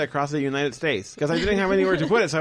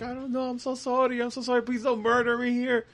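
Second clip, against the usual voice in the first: panicked apology; 8 semitones higher than usual. Retold or imitated. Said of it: "I was like".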